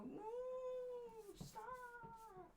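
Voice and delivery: very high, soft squeak; squeaky hurt voice. Retold or imitated. Defending the droid from the critic, squeaky and hurt. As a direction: plead in a tiny squeaky voice. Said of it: "I'd go".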